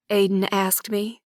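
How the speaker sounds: ordinary delivery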